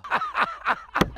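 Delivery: high-pitched burst of laughter; laughing. Acted. Host laughing at the guest's answer, high laugh. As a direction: let out a high cackle.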